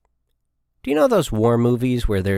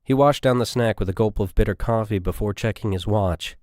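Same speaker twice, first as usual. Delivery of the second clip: plain reading voice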